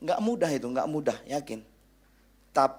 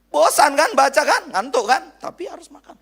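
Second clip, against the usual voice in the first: high, loud and pressing; emphatic preaching shout; 13 semitones higher, 9 dB louder than usual. Real, not acted. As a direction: push the point hard. Preacher stressing a point.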